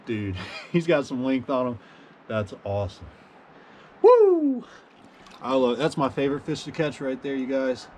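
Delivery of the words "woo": high "woo" of real delight; happy whoop. Real, not acted. Angler celebrating his favorite fish, delighted whoop. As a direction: let out a high-pitched whoop.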